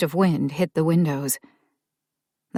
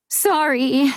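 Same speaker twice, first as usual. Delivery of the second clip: put-on high, small child's voice; a little girl apologizing; child's timid apology; 10 semitones higher, 4 dB louder than usual. A narrator's reading.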